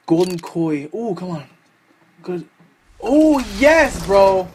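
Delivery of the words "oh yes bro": raised, loud cry of delight; real burst of relief and joy; excited cheer. Real, not acted. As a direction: celebrate a lucky win out loud. Celebrating a good drop.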